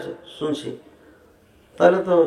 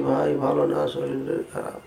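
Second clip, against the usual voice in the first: low voice sinking into a whisper; hushed, fading almost to nothing; speaking softly; 9 dB quieter than usual. Shown as a demonstration. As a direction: drop to a quiet, confiding undertone. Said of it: "এটা হলো আস্তে বলা".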